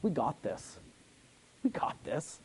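low, hushed assurance dropping into a whisper; quiet confidence. Shown as a demonstration. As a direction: say it low and soft, almost to yourself. Quietly confident paraphrase of the verse.

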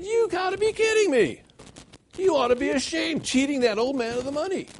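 shocked, calling out a cheat; incredulous outburst. Retold or imitated. Pastor confronts a cheating buyer.

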